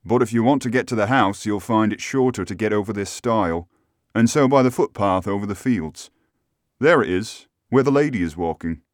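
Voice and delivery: coachman giving directions in a put-on voice; obliging driver voice; helpful local driver. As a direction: play the driver pointing out the footpath.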